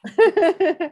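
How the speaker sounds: high, loud burst of laughter; emotional laugh. Real, not acted. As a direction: laugh out the feeling. Emotional laugh after story.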